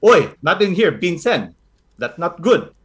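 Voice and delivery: a hint of a stern lola catching a thief; Lola Carly scolding. Lola Carly catching Vincent stealing, mild scolding. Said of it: "She goes".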